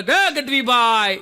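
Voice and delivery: slow, drawn-out call of હે Gadhvibhai; drawn-out loud hail. Retold or imitated. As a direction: call across the street to a friend. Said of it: "મને રાડ પાડીને બોલે".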